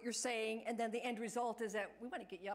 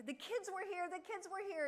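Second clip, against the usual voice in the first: put-on excited old resident; 8 semitones higher than usual. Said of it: "she would kind of wake up and say".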